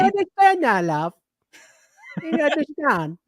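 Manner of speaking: mock British accent. Teasing a UK viewer in the chat, mock British accent. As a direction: put on a cheeky British accent. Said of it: "talk like this the whole show".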